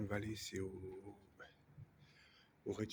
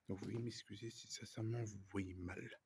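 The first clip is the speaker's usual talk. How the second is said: ordinary talk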